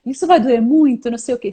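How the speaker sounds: choramingo fingido de paciente com medo, em tom mais agudo; medo encenado. Acted. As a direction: imite um paciente alarmado no dentista. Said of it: "tá com medo de passar no dentista". (medo de dentista, exagerado de brincadeira)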